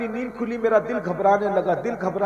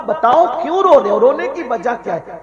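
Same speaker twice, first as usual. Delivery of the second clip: much louder, higher, pressing ask; urgent loud question; 4 semitones higher, 8 dB louder than usual. Acted. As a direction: press him loudly for the reason. Prophet asks why he cries.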